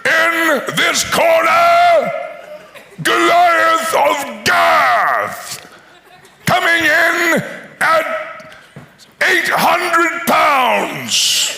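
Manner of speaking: very slow, shouted ring announcer; boxing announcer. Acted. Ring announcer introduces Goliath, booming and very slow. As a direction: stretch every word like a fight night intro. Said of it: "there's no announcer".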